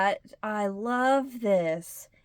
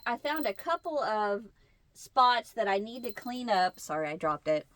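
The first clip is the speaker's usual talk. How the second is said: normal talking voice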